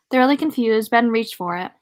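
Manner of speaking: ordinary delivery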